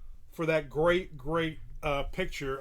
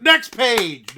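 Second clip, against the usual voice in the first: full shout; 5 semitones higher, 15 dB louder than usual. Acted. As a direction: belt it out much louder, high. Repeats the call even louder.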